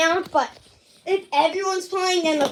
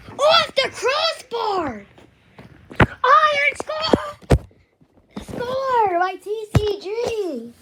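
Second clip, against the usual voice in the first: goal call; 7 semitones higher, much slower than usual. Real, not acted. Kid announcer calling a crossbar goal, high drawn-out shout. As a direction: belt out the crossbar goal like a broadcaster.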